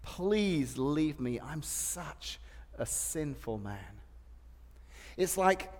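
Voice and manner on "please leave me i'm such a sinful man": slow, soft, broken confession; humbled plea. Retold or imitated. Peter kneeling before Jesus, slow humbled plea. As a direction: plead quietly on your knees. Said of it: "fell to his knees".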